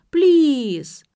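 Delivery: high loud whiny plea; whining. A narrator's reading. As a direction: whine the please. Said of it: "ныла Нелли".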